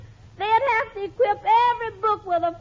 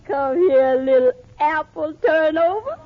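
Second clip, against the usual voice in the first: romantic Pomeroy; 3 semitones lower than usual. Acted. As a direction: croon it low like a hammed-up lover. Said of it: "is your boyfriend Pomeroy still as romantical as ever".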